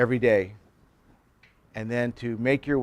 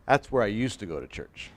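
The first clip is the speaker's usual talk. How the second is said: deadpan punchline; 4 semitones lower, 6 dB quieter than usual. Acted. Punchline of islander joke.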